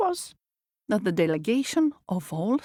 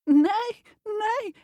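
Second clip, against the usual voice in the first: high whinnying nay nay; high neigh; 12 semitones higher than usual. Acted. The pig neighing to the others, high. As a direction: neigh like a horse. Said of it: "said the pig".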